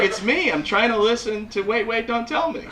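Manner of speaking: high-pitched exasperated wife; mock aggrieved wife voice; complaining wife. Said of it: "She's like".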